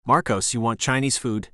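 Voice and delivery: plain reading voice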